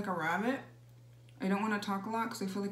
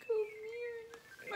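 mock delight; 12 semitones higher, 3 dB quieter than usual. Mom overjoyed at compliment.

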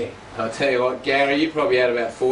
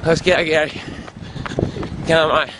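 cheering on; 2 semitones higher, 6 dB louder than usual. Real, not acted. Cheering goat in fun run.

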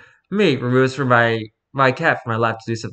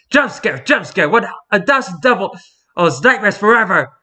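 mock jump scare; 7 semitones higher than usual. Narrator pranks viewers with a high hammed-up shriek. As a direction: yell it to startle the viewer.

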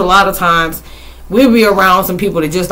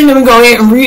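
repeated louder through a laugh; giggly, raised retake; 5 semitones higher, 7 dB louder, faster than usual. Retold or imitated. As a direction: say it again, cracking up.

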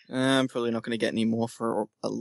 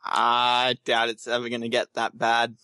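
ordinary delivery

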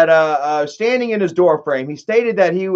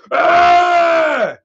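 high, loud battle cry; charging yell; 11 semitones higher, 4 dB louder than usual. Retold or imitated. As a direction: let out a charging roar. Isaac bull rushes the man.

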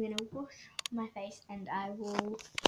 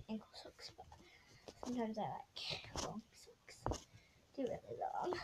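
whispering; 2 semitones lower, 10 dB quieter than usual. Real, not acted. Whispering while filming routine.

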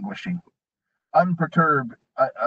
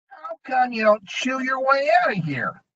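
goblin taunt; 11 semitones higher than usual. A narrator's reading. Goblin taunts dwarf in cell.

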